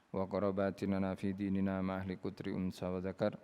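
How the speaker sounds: soft, quick Arabic prayer recitation; closing prayer. Acted. (doa penutup dibaca perlahan)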